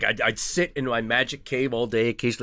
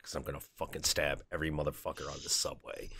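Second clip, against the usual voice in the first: muttered mock threat; 6 semitones lower, 12 dB quieter, faster than usual. Mock threat, low quiet.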